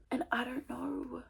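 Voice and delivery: slow, unvoiced, hushed; a soft, slow "and I don't know"; whispered. Real, not acted. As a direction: breathe it out, defeated. Repeated, first hushed.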